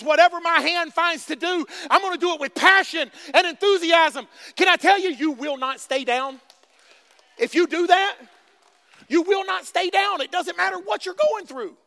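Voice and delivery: loud, high preaching; fired-up sermon; full-force preaching. Acted. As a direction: preach it, building.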